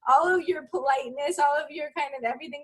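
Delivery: gushing through giggles about mom chaos; excited laughing rant. Real, not acted. Mom laughing with the host about postpartum chaos, excited and high.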